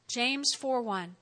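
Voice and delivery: ordinary delivery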